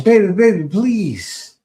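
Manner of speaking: loud, hammed-up pleading; mock begging. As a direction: beg like a needy guy in the DMs, overdone.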